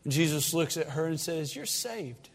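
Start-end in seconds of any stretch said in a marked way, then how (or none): 1.6-2.3 hushed blessing. Acted. Jesus declares her saved.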